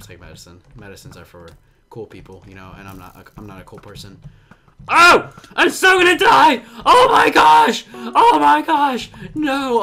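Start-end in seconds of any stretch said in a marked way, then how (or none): 4.9-9.8 high, loud panicked shouting; shrieking, slowed panicked yells; freaking out. Real, not acted. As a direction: scream as you're about to die. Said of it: "I was freaking out".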